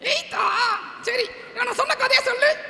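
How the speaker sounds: very high puppet-character voice; டிங்கன். Pretend. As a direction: call out to the crowd in a squeaky high voice. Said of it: "டிங்கன் வந்து ஒரு கதை". (மிக உயர்ந்த பொம்மைக் குரலில்)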